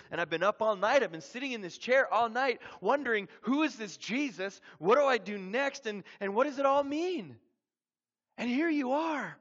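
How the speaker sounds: loud, high-pitched pleading, building to a peak; desperate urgency. Retold or imitated. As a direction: plead for answers after a sleepless night. Clyde urgently recounts dream, pleads.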